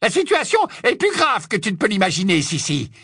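high, agitated witch voice; urgent alarm. Acted. Witch warns her sister of grave trouble, urgent. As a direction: press the danger on them, pitched high.

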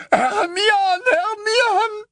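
very high, loud anguished howl; Ron's bellowing. A narrator's reading. Ron drowning out the torturer with cries, desperate bellow. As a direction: bellow her name over everything. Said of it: "avait recommencé à beugler".

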